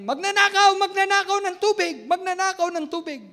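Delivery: loud, high mock accusation of "Magnanakaw"; accusing yell. Preacher imitating a neighbour's angry accusation, loud high yell. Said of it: "Sabi sa akin".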